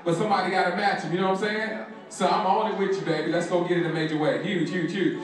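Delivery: ordinary delivery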